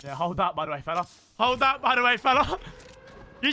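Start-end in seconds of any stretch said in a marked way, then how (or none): 1.4-3.5 higher, cocky taunt; high gloating taunt at a downed enemy. Real, not acted. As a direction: rub it in the enemy's face. Mocking a beaten enemy.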